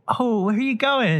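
Claire Danes. Acted. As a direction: lightly voice her asking where he's off to. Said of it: "And she goes".

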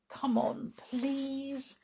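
high, soft entreaty; pleading. A narrator's reading. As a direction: beg them gently to join in. Tom begs the gang to help.

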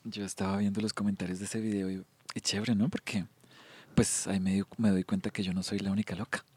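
sidle in quietly, as if it's nothing; imitating mom being offhand, softly; feigned casualness. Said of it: "ahí como quien no quiere la cosa".

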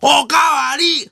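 loud, high, drawn-out shout; boisterous calling out. Acted. Comedian at a diner demanding seconds, loud high shout. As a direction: holler slowly across the restaurant.

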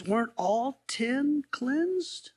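slow, drawn-out question. Retold or imitated. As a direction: let Jesus ask it slowly. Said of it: "Jesus says".

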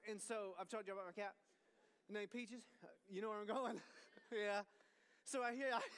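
normal talking voice

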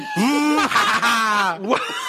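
high, mock villain cackle; imitated gloating laugh. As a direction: act out a big triumphant laugh. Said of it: "then I was like".

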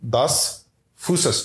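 "Das Frühstück" said slowly with a German accent; German accent demo. Shown as a demonstration. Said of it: "that's how you say it".

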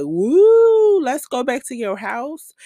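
high, loud mock woo; mock excitement. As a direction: ham up a giddy cheer. Mocking what he expected.

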